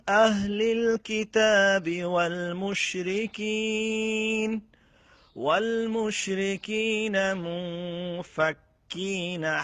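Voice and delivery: Quran recitation. Acted. Teacher reciting the verse for students, slow melodic chant. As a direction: chant it with long held vowels.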